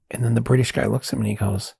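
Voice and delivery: usual speaking voice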